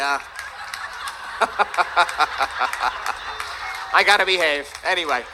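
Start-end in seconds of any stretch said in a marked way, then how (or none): 0.2-3.9 long breathy run of laughter; real laughing fit. Real, not acted. Speaker losing their place on stage, real laughing fit. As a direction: crack up helplessly in rapid pulses. Breaks up after losing the thread.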